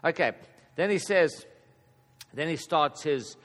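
ordinary delivery

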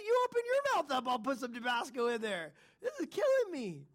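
mock the mother's sing-song excuse; imitating mom. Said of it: "my mom would put Tabasco".

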